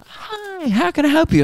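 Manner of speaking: mock her sweet professional hi; chirpy service voice. Mocking the Qantas lady greeting him, high chirpy service voice. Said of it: "then she goes".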